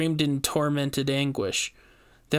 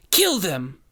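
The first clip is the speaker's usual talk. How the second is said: command; 4 semitones higher, 4 dB louder than usual. A narrator's reading. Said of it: "she commanded her brother".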